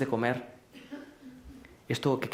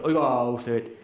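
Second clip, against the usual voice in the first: mock reprimand; 2 semitones lower, 4 dB louder, much slower than usual. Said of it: "No está en la reacción".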